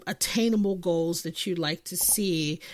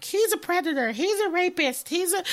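high-pitched imitation of people accusing; mock their insistent accusations in a raised pitch; mimicked accusers; 10 semitones higher than usual. Mimics accusers in retold arguments.

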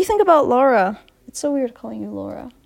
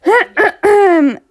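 loud, long throat-clear; 8 semitones higher, 10 dB louder than usual. Real, not acted. Host collecting herself after singing on air, loud throat-clearing.

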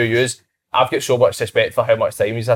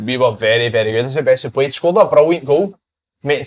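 ordinary talk, nothing marked

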